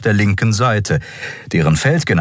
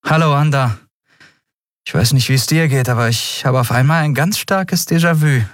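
faintly cheeky; 8 semitones higher than usual. A narrator's reading. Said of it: "lächelte matt, eine blasse Kopie ihres üblichen, ungenierten Auftretens".

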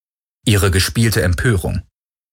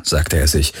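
usual speaking voice

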